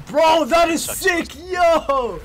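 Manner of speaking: loud, slow, high excited yell; hyped shout. Real, not acted. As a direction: shout in pure hype. Hyped reaction to the ult.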